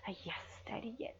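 unvoiced breathy whisper; hushed breathy yes. Hushed aside in roleplay.

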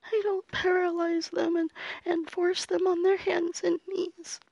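high, tearful, begging voice; terrified crying plea. Acted. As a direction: sob the words out in fear. Crying captive pleading.

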